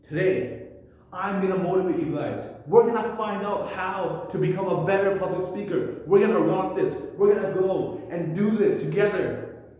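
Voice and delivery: booming motivational speech to a big audience; energetic motivational delivery. Shown as a demonstration. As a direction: fire up the crowd like a motivational speaker. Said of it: "if I move with purpose and say".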